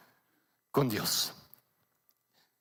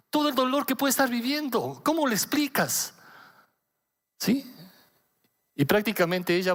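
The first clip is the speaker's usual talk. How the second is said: ordinary delivery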